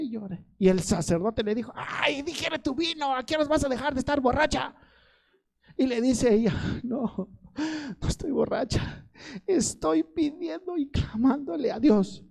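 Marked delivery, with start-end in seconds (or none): 1.8-4.7 high-pitched mock scolding; scolding priest. Priest scolding a praying woman. 6.8-12.2 high, slow pleading reply; imitating an anguished woman's earnest denial. Weeping woman answers the priest.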